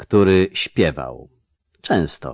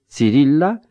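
put-on small, high, questioning girl's voice; voice breaking; 7 semitones higher, 4 dB louder than usual. A narrator's reading. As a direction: falter upward as if about to break. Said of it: "głos dziewczyny złamał się jak patyczek".

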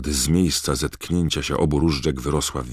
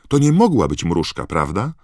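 Hermiona's voice; 8 semitones higher, 9 dB louder than usual. A narrator's reading. Hermiona voiced appealing to friends.

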